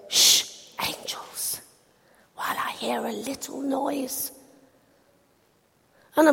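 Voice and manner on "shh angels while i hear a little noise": soft whispered hush; little girl whispering what God says. Acted. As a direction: breathe it like a secret to the angels. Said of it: "talking in a very quiet voice".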